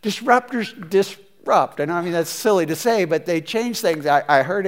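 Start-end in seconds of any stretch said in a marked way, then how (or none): 0.0-1.8 each word set down slowly and high; drawn out, pointed delivery; slow emphatic statement. Real, not acted. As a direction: land it like a slogan.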